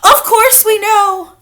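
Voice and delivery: high witch chorus. A narrator's reading. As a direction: reassure their leader.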